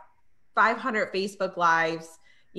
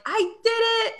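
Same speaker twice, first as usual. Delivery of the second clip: high, excited shout; triumphant; 14 semitones higher, 4 dB louder, slower than usual. Retold or imitated. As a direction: cry it out in triumph. Triumphant report to Nadia.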